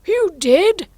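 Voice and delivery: very high, loud insistence; Hatter. Acted. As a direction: contradict him in a loud, high voice. Hatter insists against March Hare.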